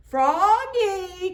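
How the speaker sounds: high, loud summoning call. Acted. Principal calling Froggy, loud high call. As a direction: call across the snow. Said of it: "called the principal".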